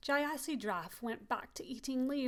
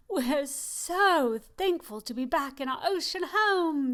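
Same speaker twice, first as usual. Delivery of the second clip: exclaiming; 4 semitones higher, 6 dB louder than usual. Acted.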